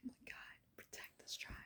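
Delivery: choked, breathy whisper; tearful whisper. Real, not acted. Telling viewers of nightly prayers, whispering while crying. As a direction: whisper through tears. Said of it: "not wanting to get emotional today".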